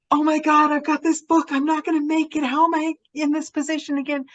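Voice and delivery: high-pitched mock panic, rushing at the end; panicked complaining. Voicing a panicked complaint to friends.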